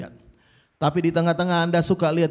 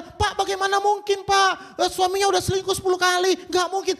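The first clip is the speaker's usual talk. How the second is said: much higher, fast anguished protest; despairing wife; 11 semitones higher than usual. Retold or imitated. Betrayed wife pleading with the pastor, high and fast. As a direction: plead that it's hopeless, rushing. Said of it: "Kalau Anda berkata".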